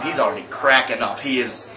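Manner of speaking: ordinary talk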